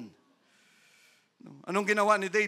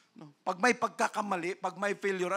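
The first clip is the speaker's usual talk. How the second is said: ordinary talk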